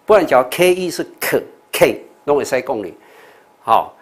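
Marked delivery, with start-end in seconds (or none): none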